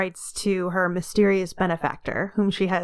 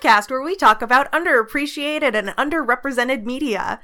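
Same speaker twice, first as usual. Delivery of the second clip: tinny echoey recording; metallic voice filter; 2 semitones higher than usual. Real, not acted. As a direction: sounds like a tinny echoey line. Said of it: "that weird echoey tinny sound".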